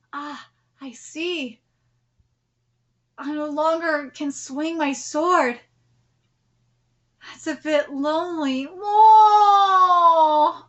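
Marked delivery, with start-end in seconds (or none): 0.1-8.6 lower, softer solemn reading; lightly voiced respectful rival; quiet character voice. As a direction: read it as a dying swordsman's tribute. Reads a character's lines.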